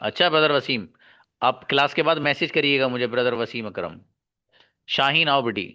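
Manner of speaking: ordinary talk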